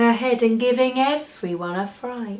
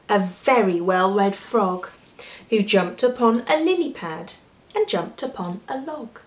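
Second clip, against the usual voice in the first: usual speaking voice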